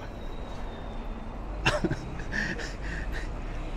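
quiet, breathy little chuckle; knowing laugh, kept low; soft laugh. Real, not acted. Vlogger laughing off what he sees, soft knowing chuckle. Laughs after doubting remark.

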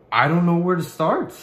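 normal talking voice

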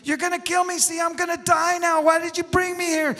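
high, loud panicked protest; hammed-up hysterical scream. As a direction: yell as the terrified man being hoisted. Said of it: "he's screaming".

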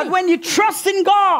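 high, ringing exhortation; emphatic preaching. Real, not acted. Preacher urging church to trust God, emphatic preaching.

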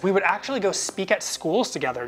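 ordinary talk, nothing marked

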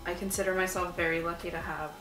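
usual speaking voice

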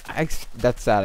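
normal talking voice

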